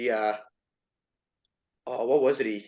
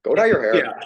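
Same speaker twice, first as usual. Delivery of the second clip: a louder jeering chirp again; mock chirp; 6 dB louder than usual. Echoing the chirp to a friend, mock jeering. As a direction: toss the chirp back with a grin. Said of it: "chirps that they come up with".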